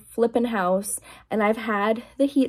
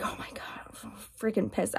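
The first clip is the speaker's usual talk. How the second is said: softer, fast retold grumble under the breath; muttered anger; 11 dB quieter, faster than usual. Vlogger retelling her heated kitchen anger, muttered fast. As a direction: mutter it fast like you're fuming quietly. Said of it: "I was getting angry".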